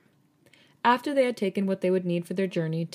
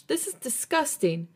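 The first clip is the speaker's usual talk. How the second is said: outburst; 8 semitones higher than usual. A narrator's reading. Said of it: "her outburst".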